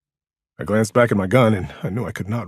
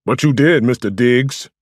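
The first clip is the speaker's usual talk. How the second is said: sharp retort, higher and louder; snapping; 5 semitones higher, 8 dB louder than usual. A narrator's reading. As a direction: snap back accusingly. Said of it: "Samson snapped".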